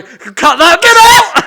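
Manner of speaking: sudden loud, high shout; embarrassed shout. Real, not acted. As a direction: yelp it out, mortified. Embarrassed outburst at co-host.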